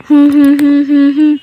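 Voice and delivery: loud humming tune to copy; humming demo. Shown as a demonstration. Owner hums loudly so the pet joins in. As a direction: hum the notes clearly for the pet. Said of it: "হ্যাঁ, করো।".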